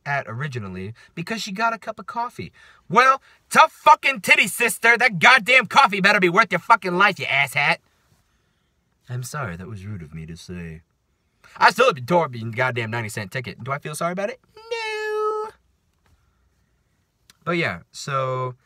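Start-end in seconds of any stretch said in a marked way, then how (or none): 2.9-7.8 loud, high scornful retort; mock furious. Worker telling off coffee woman in imagination, mock furious. 9.1-10.8 soft, low apology; mock contrite. Ranter apologizing for own rudeness, mock contrite. 14.6-15.5 very high, drawn-out squeak; comic denial. Pretend. Ranter denying any regret, comically squeaky. Answers own question about regret.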